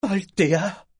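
loud, high cry of her name; surprised gasp. A narrator's reading. Mother shocked to see her lost daughter, crying out her name. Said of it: "s'exclama sa mère avec un hoquet de surprise".

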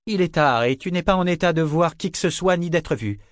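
high-pitched stern scolding; sharp maternal reprimand; curt. A narrator's reading. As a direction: put him firmly in his place. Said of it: "dit Yanni d'un ton sec".

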